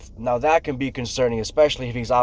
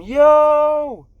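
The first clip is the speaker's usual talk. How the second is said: much higher, louder long holler; yelling; 6 dB louder than usual. Retold or imitated. Worker yells at the unresponsive crane operator, louder yelling. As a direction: yell it out and hold it. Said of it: "I'm yelling at him".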